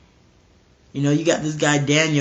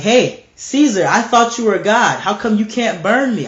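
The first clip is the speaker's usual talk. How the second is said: high, taunting call; mocking; 5 semitones higher, 3 dB louder than usual. Man on the stake taunting Caesar, mocking. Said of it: "I will mock".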